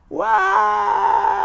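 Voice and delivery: sudden high yelp of surprise; surprised shout. Real, not acted. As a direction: shout it out in shock. Narrator reacts to the kick.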